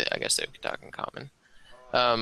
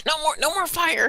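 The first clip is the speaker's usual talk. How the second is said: much higher, squeaky goblin plea; frantic creature begging; panicked goblin; 4 dB louder than usual. Acted. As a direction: beg like a scared little goblin. Goblin pokes head out, pleads.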